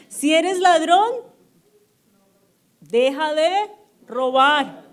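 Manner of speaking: preacher booming a command from the Bible; proclaimed verse. Acted. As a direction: thunder the verse at the congregation.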